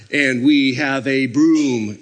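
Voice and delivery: loud, heavily drawn-out hint; dropping hints. Acted. Worker hinting to oblivious helper, loud pointed hint. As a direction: spell it out slowly for someone oblivious. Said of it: "you drop the little hints".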